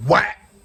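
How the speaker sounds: imitated chair whack. Chair shot sound imitated.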